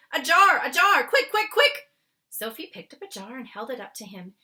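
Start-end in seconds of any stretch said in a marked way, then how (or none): 0.1-1.9 frantic, high and loud calls; high loud frantic giant's cries; urgent. Acted. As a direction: hurry her along, voice climbing. Urgent catching dream.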